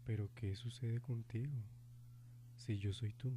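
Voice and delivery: sinister soft taunt. Acted. Ghost taunting softly.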